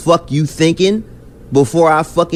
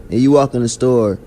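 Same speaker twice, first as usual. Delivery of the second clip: ordinary talk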